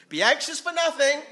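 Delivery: loud, high-pitched proclamation; emphatic command. Acted. Preacher answering the anxious believer with scripture, emphatic. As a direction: cut the worrier off with a booming verse.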